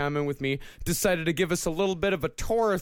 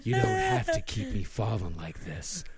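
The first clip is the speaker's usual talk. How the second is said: calm psychopath; 4 semitones lower than usual. Acted. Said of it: "do it in the serial killer voice".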